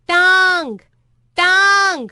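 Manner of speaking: loud, high, drawn-out shout. A narrator's reading. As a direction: shout it at someone hard of hearing. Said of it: "मैंने चिल्ला के कहा". (ज़ोर से चिल्लाकर)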